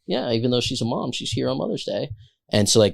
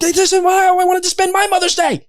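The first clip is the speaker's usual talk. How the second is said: high, loud mocking wail; exasperated echo; 5 dB louder than usual. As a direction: throw her whine right back. Chef mocking back, exasperated.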